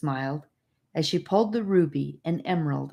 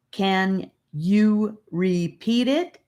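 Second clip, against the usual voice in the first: slow and deliberate; boy impatient for the message to be repeated; 4 semitones higher, 4 dB louder, much slower than usual. A narrator's reading. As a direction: press for an answer, impatient.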